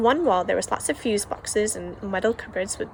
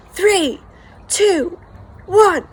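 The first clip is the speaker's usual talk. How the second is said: loud, high counting down; countdown shout; 10 semitones higher, 10 dB louder, much slower than usual. Acted. Crowd in the hall counting down to the show, loud chant.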